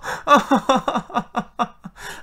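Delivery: high, breathy scared laughter; real panicked giggling; nervous laughter. Real, not acted. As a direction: laugh it out of pure nerves. Scared laughing at scary game.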